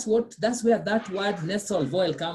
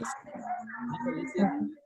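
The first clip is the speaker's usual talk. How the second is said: 1 whisper; 7 semitones higher, 7 dB quieter, much slower than usual. Real, not acted. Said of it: "hush before demo".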